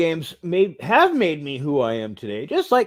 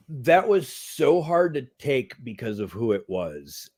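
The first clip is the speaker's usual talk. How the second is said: ordinary talk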